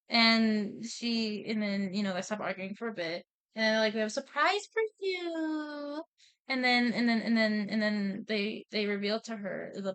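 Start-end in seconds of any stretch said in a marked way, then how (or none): 3.9-6.0 high, stretched-out last word; acted-out playful singsong; singsong announcement. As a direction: coo it teasingly like a parent with a surprise. Dads announce surprise, acted out.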